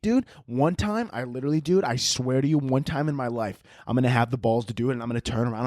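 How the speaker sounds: ordinary delivery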